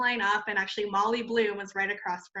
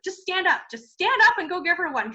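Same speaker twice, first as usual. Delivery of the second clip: much higher, eager push to stand up; urgent nudge; 8 semitones higher, 6 dB louder than usual. Retold or imitated. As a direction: urge your friend on, insistent. Beste Freundin drängt zum Aufstehen.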